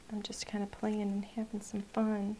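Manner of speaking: soft unvoiced whisper. Real, not acted. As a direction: whisper it to yourself. Aside while painting.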